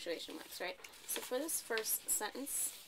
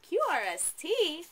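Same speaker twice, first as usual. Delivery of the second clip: higher, louder, slow excited "Q R S T"; excited reading; 9 semitones higher, 12 dB louder, slower than usual. Shown as a demonstration. Teacher models an exclamation for the student: excited, higher and louder. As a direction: belt it out like big news. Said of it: "Because we're feeling how?".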